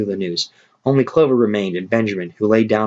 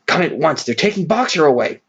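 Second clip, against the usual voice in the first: higher, urgent mock shout; shouted alarm; 7 semitones higher than usual. Benjamin gallops up to warn the animals, urgent shout. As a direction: call them over in a hurry. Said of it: "he shouted".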